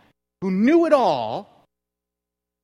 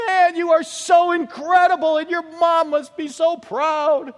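high, loud, starstruck woman's gush; 12 semitones higher, 6 dB louder than usual. Retold or imitated. A woman in the crowd praising Jesus, high gushing. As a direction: gush like an awestruck fan. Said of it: "This woman just says".

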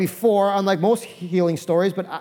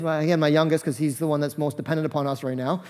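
ordinary talk

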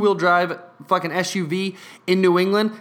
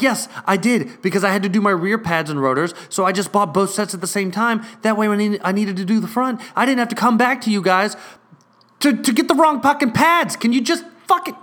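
rising higher and louder toward a near shout; building anger; 5 semitones higher, 3 dB louder than usual. Retold or imitated. Arguing with the clerk over the pads, building to a loud outburst. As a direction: let frustration climb until it boils over. Retold heated argument with clerk.

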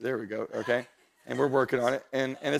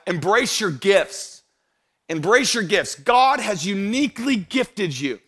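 higher, louder pulpit delivery with drawn-out key words; fervent preaching; 7 semitones higher, 6 dB louder, slower than usual. Real, not acted. Preacher urging church to embrace their gifts, fervent preaching. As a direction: preach it slowly and forcefully.

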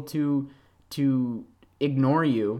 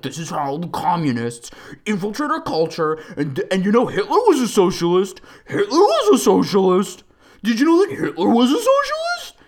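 put-on voice climbing higher and louder to a squeal; acting like an alt-righter; 7 semitones higher, 5 dB louder than usual. Mocking an alt-righter insisting Hitler was socialist, rising indignation. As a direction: build a smug rant to a shrill punchline. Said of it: "I'm acting like I'm talking to one of them".

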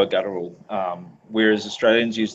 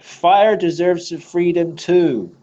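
slow mock-grand pyro; 8 semitones higher, 4 dB louder, slower than usual. Playing a pyromaniac.

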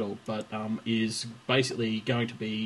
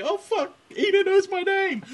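mock starstruck, much higher and a bit louder; starstruck squeal; 5 dB louder than usual. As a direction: squeak it out like a dazzled fan. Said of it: "And she was like".

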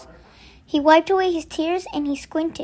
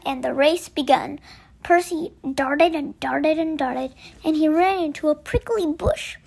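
ordinary delivery, nothing marked

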